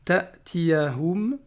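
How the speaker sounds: Ta'tiyahum very slow, sound stopped at the hamza; hamza cut short. Shown as a demonstration. Teacher showing the hamza with sukun, cut. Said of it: "une hamza avec un soukoun".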